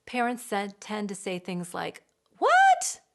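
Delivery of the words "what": high, loud disbelieving yelp; shocked what. Retold or imitated. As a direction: shriek the word in disbelief. Parent shocked at child's complaint.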